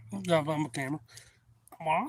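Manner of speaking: ordinary delivery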